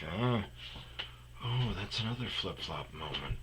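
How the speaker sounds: quiet muttered aside. Retold or imitated. Muttered thought, turned off.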